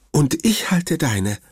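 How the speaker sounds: high, lightly voiced worried grandmother; grandma's voice. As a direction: speak up high as the fussing grandma. (hohe, besorgte Oma-Stimme)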